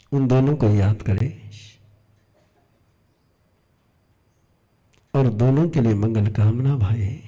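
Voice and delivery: unhurried prayerful guidance with long pauses; guided meditation. Acted. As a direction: lead them inward, phrase by phrase, in an even voice. Leads closed-eye forgiveness meditation. (ठहर-ठहर कर ध्यान करवाते हुए)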